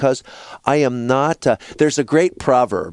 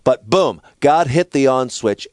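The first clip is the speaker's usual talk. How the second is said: normal talking voice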